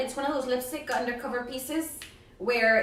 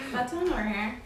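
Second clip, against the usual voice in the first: ordinary talk, nothing marked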